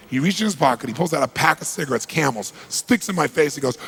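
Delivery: ordinary delivery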